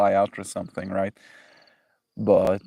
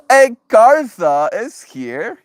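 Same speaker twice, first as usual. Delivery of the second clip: excited greeting; 12 semitones higher, 10 dB louder, much slower than usual. Real, not acted. Greeting arriving viewer.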